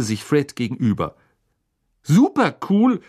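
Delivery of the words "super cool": high, loud, stretched-out praise; excited fan's exclamation; awed enthusiasm. Acted. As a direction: draw it out in admiration. Twins' friend joins the raving.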